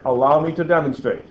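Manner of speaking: ordinary talk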